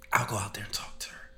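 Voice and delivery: whispered, voiceless quote; retold whisper. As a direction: hush it like you're sneaking out. Whispered retold quote in quiet room.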